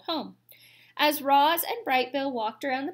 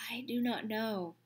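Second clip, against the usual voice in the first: soft unvoiced robot admission; whispered robot; 5 dB quieter than usual. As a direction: whisper it, unsure. Robot unsure of its plans.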